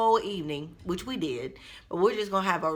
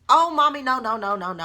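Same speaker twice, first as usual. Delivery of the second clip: put-on pleading child; 7 semitones higher, 7 dB louder than usual. Son backpedaling, voiced by mom.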